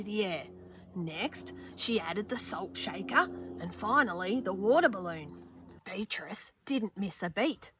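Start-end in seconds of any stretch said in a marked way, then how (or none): none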